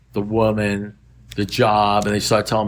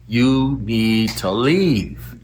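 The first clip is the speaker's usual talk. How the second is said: very slow, word by word; drawn-out firm instruction, falling at the end; slow emphatic order; much slower than usual. Retold or imitated. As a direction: spell it out for a dense friend. Repeats advice, emphatically spelled out.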